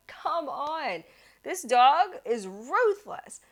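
high, drawn-out mock exasperation; retold yelling. Cyclist losing the chase with a dog, exasperated mock yell. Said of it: "I kept yelling".

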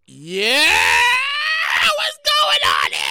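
drawn-out mock double take; puzzled man reacting to a windfall; confused and happy. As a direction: act out happy bafflement, stretching the yeah. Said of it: "he became immediately confused and happy".

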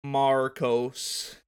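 "Marcos" drawn out with a clear American R; careful corrected pronunciation. Shown as a demonstration. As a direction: say "Marcos" deliberately, sounding the R in "Mar". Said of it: "can you say it correctly?".

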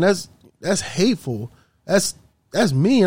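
retold shocked reluctance; dismayed protest. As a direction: plead as the appalled employee. Employee protests the boss's order.

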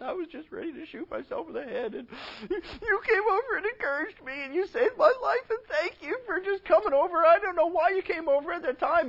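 high, hammed-up weepy voice; mock tearful mother. Preacher voicing a crying mother thanking her child, mock tearful. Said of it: "your mother's crying and in tears".

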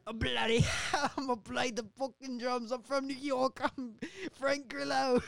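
high, hammed-up British voice; bad British accent, breaking into laughter; botched British accent. Drummer claiming to be from New York, in British accent.

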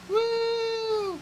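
sudden high whoop; a jokey celebratory woo; mock cheer. Sarcastic cheer about gaming curfews.

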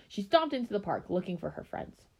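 ordinary delivery

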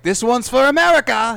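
high, loud hammed-up hero cry; mock action hero. Mocking Van Damme playing Guile, loud high hero cry. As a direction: ham up the heroic line. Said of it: "he's all like".

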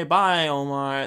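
ordinary delivery

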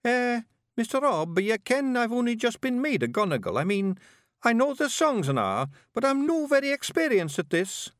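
high-pitched, apologetic Scots laddie; high Scottish character voice, unsure; worried young Gonigal. A narrator's reading. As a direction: play a nervous novice making excuses. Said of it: "The young Gonigal looked worried".

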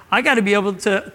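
normal talking voice

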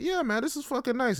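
high-pitched pleased reply; hammed-up happy squeal of approval; mock delight. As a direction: play up being thrilled, voice way up high. Acts out his pleased reply.

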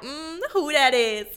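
slow, high, appreciative hum as Boaz; lightly voiced man eyeing a woman; impressed admirer. Boaz voiced noticing Ruth.

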